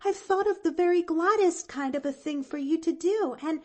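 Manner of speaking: put-on high, loud, eager young girl; bubbling over with her idea; excited child with a plan; eager little girl. A narrator's reading. Pollyanna's voice in story.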